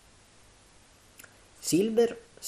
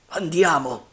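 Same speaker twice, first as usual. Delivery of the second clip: raised, louder character voice; rallying pirate chief; 5 semitones higher, 7 dB louder than usual. A narrator's reading. Silver rallying frightened men, firm and raised. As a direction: urge the men on. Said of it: "disse Silver".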